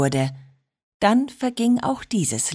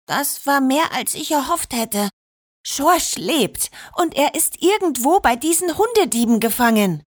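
higher, lightly voiced girl; a girl's voice, a little higher and unsure; light higher voice for Georgina, barely stammering; 6 semitones higher than usual. A narrator's reading. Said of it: "Georgina stammelte leise".

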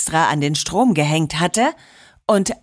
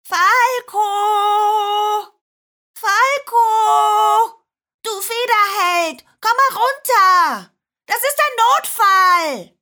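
high, drawn-out call into the sky; 13 semitones higher, slower than usual. A narrator's reading. Georgina summons the falcon for an emergency, calling out high. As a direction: shout up urgently for help from far below. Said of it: "legte die Hände an den Mund und rief".

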